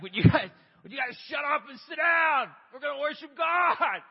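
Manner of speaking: retold dad yelling at the kids to sit down, high and loud; angry shout. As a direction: bark at the kids, re-enacting the frustration. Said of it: "I'd be so angry".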